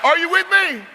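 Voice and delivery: high, loud call to the crowd; rallying call. Real, not acted. Speaker calling out to the crowd, high and loud. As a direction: fire up the audience.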